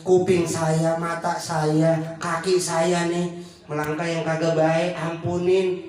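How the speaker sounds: low, slow, pleading repentance; humble prayer. Retold or imitated. Preacher voices a sinner confessing to God, low and slow. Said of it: "udah ngaku".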